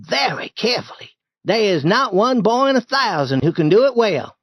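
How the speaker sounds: high, grave refusal; self-important boy Tom. Acted. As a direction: make the job sound precious.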